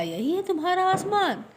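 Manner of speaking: high, amused fox voice pointing at the fruit; a sly fox laughing at the silly animals; mocking fox. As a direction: tease them like a sly fox. Fox mocks the scared animals.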